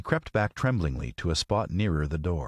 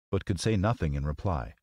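usual speaking voice

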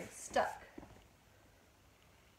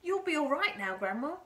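loud, higher, pleased assurance; proud announcement; 6 semitones higher, 10 dB louder than usual. A narrator's reading. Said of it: "George announced proudly".

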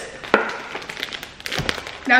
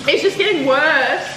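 pained; 13 semitones higher, slower than usual. Real, not acted. Spice pain continues.

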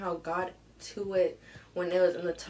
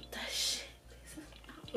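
breathy angry whisper; 12 dB quieter than usual. Real, not acted. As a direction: hiss it under your breath.

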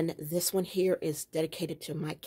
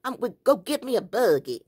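hammed-up country twang; country accent; 5 semitones higher, 5 dB louder than usual. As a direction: imitate a country husband heading for a buggy. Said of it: "that strong country accent".

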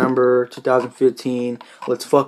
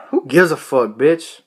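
ordinary talk

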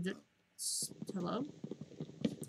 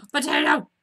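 loud gasp then a delighted shout; thrilled cry at finding a potato; excited gasp; 5 semitones higher, 10 dB louder than usual. Real, not acted. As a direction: gasp and yell it with joy.

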